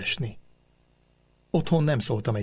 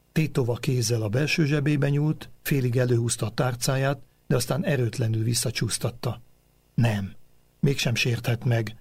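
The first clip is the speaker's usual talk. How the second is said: ordinary delivery, nothing marked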